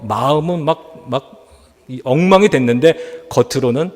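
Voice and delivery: ordinary talk, nothing marked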